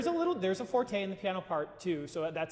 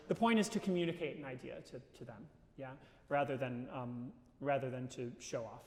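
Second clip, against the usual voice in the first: usual speaking voice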